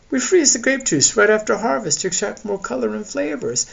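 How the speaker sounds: high-pitched character voice; high voice of a winemaker sharing know-how. Acted. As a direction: explain it in a high, lilting voice.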